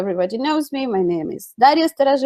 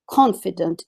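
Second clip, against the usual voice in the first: ordinary talk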